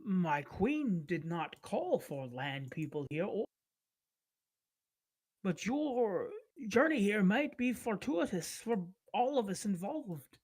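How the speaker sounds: lightly voiced eager scholar, slow and careful; polite scholarly lizard folk. Sarav greets the land people, slow and polite. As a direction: greet the strangers slowly, keen on their culture.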